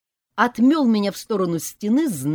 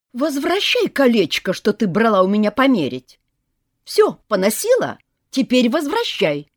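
put-on loud, high bossy old woman; greedy grandma shouting; demanding granny; 7 semitones higher, 8 dB louder than usual. A narrator's reading. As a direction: demand the ring back.

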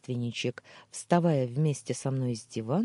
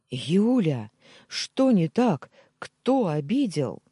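loud, higher, urgent demand; alarmed shout; 4 semitones higher, 7 dB louder, slower than usual. A narrator's reading. Husband begs sobbing wife to explain, loud and urgent. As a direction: press her hard, frightened for her.

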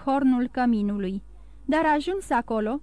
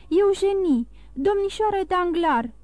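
terror turning into amazement; stunned horror; 6 semitones higher than usual. A narrator's reading. Andrea recognises Miss Danglar, horror giving way to amazement. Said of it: "îngăimă Andreea, trecând de la groază la uimire".

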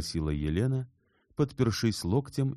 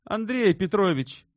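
high, loud outburst of a name; sudden exclamation; 6 dB louder than usual. A narrator's reading. Shubin suddenly cuts in on his friend, exclaiming. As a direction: cry out the name abruptly. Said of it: "воскликнул вдруг Шубин".

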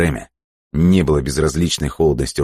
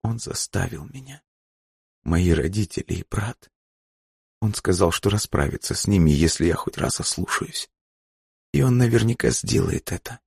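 soft, higher, frightened confession; tearful confession; 5 semitones higher, 4 dB quieter than usual. Acted. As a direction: confess quietly, in broken little phrases. Плачущая Бланка признаётся под принуждением. (тихое, горестное признание)

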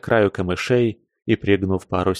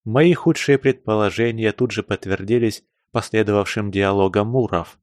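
ordinary delivery, nothing marked

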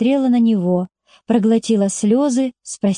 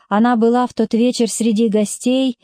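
usual speaking voice

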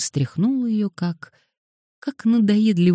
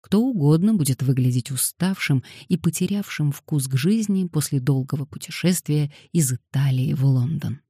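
plain reading voice

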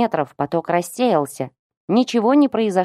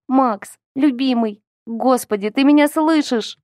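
tearful; 6 semitones higher than usual. A narrator's reading. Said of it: "а слёзы сами хлынули ручьём".

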